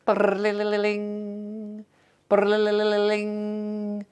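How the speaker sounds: high trilling imitation of an old phone bell; mock phone ring. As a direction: trill it like a dial phone ringing.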